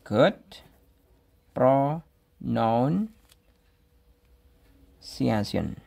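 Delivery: Good pronunciation drawn out very slowly, each syllable clear; slow careful model. Shown as a demonstration. Teacher modelling the phrase for students, slow and deliberate. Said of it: "bisa kita tulis good pronunciation".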